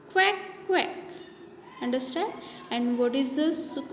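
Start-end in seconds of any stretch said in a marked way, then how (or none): 0.1-1.0 duck quack. Shown as a demonstration. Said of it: "What is the sound of duck?".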